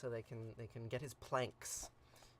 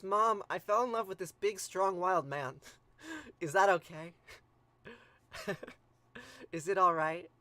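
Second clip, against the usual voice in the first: louder, giggly confession of love to mom; giddy son confessing; 3 semitones higher, 6 dB louder than usual. Pretend. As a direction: blurt it out like a smitten boy, laughing. Gilgamesh confessing love to mother, laughing.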